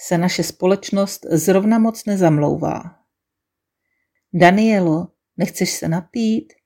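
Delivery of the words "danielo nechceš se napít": ordinary delivery, nothing marked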